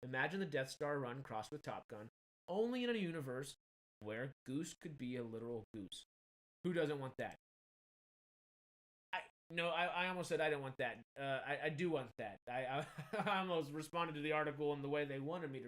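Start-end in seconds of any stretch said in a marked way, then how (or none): none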